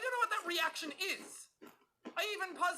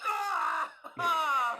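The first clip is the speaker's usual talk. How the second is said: pained yell; 2 semitones higher, 7 dB louder than usual. Real, not acted. Sound clip unbearable.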